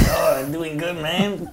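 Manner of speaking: put-on laughing through a Mexican accent. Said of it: "that Mexican accent we like to do".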